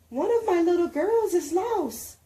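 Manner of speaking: put-on teacher alarmed about a lost girl; worried teacher. A narrator's reading. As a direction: tell them a child is missing.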